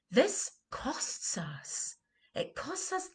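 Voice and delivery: very slow whispered emphasis. Real, not acted. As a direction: whisper it slowly, letting each word weigh. Coach whispers the key message.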